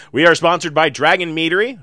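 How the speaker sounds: ordinary talk, nothing marked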